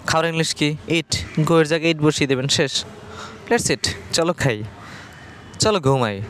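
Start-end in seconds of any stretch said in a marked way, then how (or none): none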